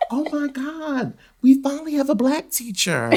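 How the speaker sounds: high-pitched mock student amazement; amazed students. Students seeing their new sub for the first time, amazed.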